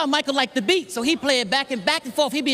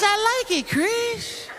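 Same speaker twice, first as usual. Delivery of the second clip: gentle high Michael; Michael impression; 9 semitones higher, slower than usual.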